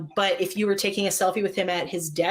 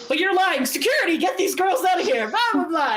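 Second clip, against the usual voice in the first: high, hammed-up indignation; mock outrage; 10 semitones higher, 4 dB louder than usual. [name] jokingly calling security on the girls, mock outrage. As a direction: imitate an outraged call for security.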